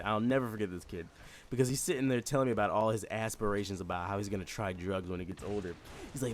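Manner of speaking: ordinary talk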